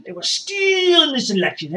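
high, stretched wail of outrage; outraged cry. Acted. Supporter retelling the election call, outraged high cry. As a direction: draw STEALING out high in outrage.

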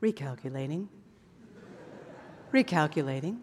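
low, soft, even sat-nav voice; calm GPS voice. Retold or imitated. Preacher imitating his calm GPS, low and soft. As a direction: mimic a calm navigation voice. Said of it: "your G P S very calmly says to you".

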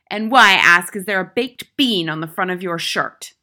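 loud, stern interrogation; domineering schoolmistress; tyrannical headmistress, demanding. A narrator's reading. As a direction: demand it sharply of the boy.

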